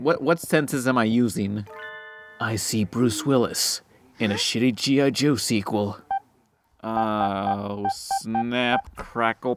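usual speaking voice

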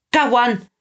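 high-pitched triumphant call; yelled. A narrator's reading. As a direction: call out your catch. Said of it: "Pickles yelled".

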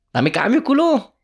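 high, loud demand for change; annoyed demand. Retold or imitated. Tourist demands his change from the Egyptian vendor, annoyed. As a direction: bark it at the vendor.

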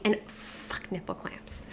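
slow whispered aside. Real, not acted. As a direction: breathe it out, no voice.

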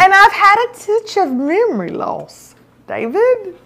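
high-pitched mock indignation; comic protest, pitched well up; aggrieved complaint. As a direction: accuse him with played-up hurt. Aggrieved complaint to husband, played up.

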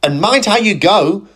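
high, loud sharp caution; warning. Acted. Gladys warning Philip, high and sharp. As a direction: scold him firmly. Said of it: "warned Gladys".

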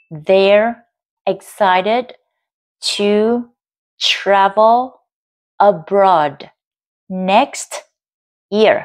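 word-by-word dictation, pause after each; separated, firmly sounded words; slow answer read-out. Shown as a demonstration. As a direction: read slowly, one word at a time. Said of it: "Mình sẽ đọc chậm đáp án".

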